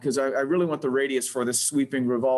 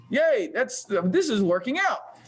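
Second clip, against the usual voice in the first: higher, brighter cheer of relief; real delight; 6 semitones higher, slower than usual. Real, not acted. Instructor cheers when the CAD extrusion finally succeeds, delighted. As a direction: let out a happy "Yay" as it works.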